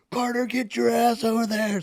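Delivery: high, loud yelled command; barked order. Retold or imitated. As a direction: order him across in battle. Team leaders yelling orders.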